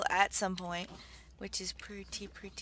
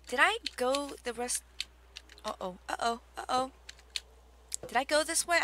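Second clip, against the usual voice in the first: ordinary talk, nothing marked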